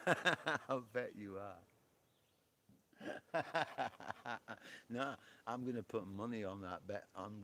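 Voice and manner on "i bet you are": slow, drawn out through laughter; laughing tease. Real, not acted. Streamer teasing a viewer about being pale, slow and laughing.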